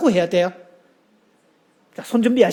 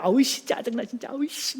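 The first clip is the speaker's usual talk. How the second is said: irritated grumble; 4 semitones higher, 6 dB quieter than usual. Retold or imitated. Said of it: "남편 보고 막 성질을 냅니다".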